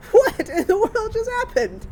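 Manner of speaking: high, loud, incredulous exclamation; baffled disbelief. Retold or imitated. Mother stunned by her daughter's fish remark, incredulous.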